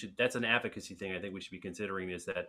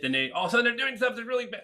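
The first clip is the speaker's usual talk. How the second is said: strained forced voice; 13 semitones higher, 7 dB louder, faster than usual. Shown as a demonstration. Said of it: "I could hurt myself".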